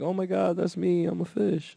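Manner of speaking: put-on high, soft little-girl voice; tiny high voice of a delighted kid; imitated little girl. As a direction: imitate an awed small child, hushed and high.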